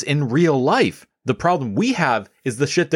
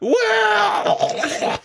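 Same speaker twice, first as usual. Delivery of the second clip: very high, laughing "Wow!"; mock joyful shout. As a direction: whoop it out through a laugh.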